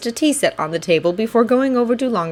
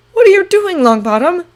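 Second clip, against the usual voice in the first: confused; 11 semitones higher, 6 dB louder than usual. Acted. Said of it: "Draco asked in confusion".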